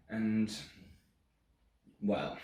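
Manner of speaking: understated, suggestive pause word; coy hesitation. Acted. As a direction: hold back coyly before the reveal. Coyly hinting at what followed.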